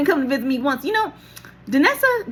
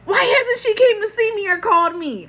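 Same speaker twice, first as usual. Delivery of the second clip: high, loud indignant demand; furious; 11 semitones higher, 6 dB louder than usual. Retold or imitated. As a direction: demand an answer in outraged disbelief. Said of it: "I was furious".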